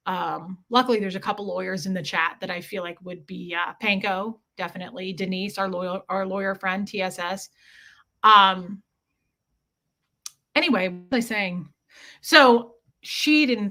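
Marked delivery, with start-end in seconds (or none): none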